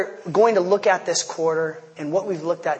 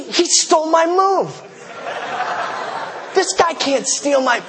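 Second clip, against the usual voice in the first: high, incredulous lion voice half-laughing; bewildered lion; 8 semitones higher than usual. Acted. Pastor voicing a lion outwitted in the office, high disbelieving cry. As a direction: squeal it out like a lion that just got beaten.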